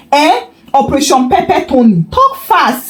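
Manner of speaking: thrilled gossip, rushing to hear the plan; excited. Acted. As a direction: pitch jumps high, eager and gleeful. Mama Bose excited by plan.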